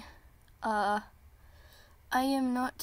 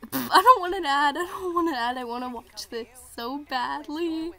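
frustrated protest; 2 semitones higher, 5 dB louder than usual. Real, not acted. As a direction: whine loudly at the interrupting ad. An ad interrupts the awaited premiere.

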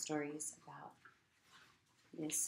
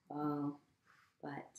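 usual speaking voice

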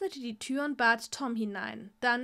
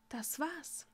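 soft, quiet answer; quiet reply; 3 semitones higher, 9 dB quieter than usual. Acted. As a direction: answer softly.

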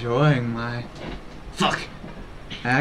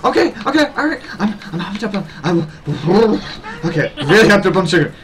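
hyped on sugar; 5 semitones higher than usual. Pretend. Streamer reading out the donated new accent, hyped up. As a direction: act wired on caffeine and sugar, stumbling over words. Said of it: "high on caffeine and sugar".

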